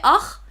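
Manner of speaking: a loud, dark-vowelled ach, the cry for ouch; ouch pronunciation. Shown as a demonstration. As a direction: ach said hard, like 'okh'. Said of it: "then it means ouch in Arabic".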